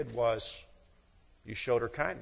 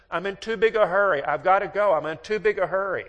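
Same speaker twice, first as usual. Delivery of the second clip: higher, louder, insistent wife; impatient wife; 6 semitones higher, 8 dB louder than usual. Retold or imitated. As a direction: cut him off in an impatient rush to leave. Wife refusing in a hurry.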